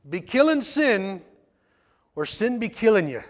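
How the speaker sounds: put-on a deliberately horrible Irish accent for laughs; Irish brogue. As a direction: put on a bad Irish lilt. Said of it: "If I had an Irish brogue".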